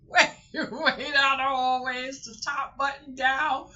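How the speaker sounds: high, loud mock directions; playful calling out. Pointing departing guests to the elevator button, high playful holler. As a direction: holler far down a hallway, hammed up.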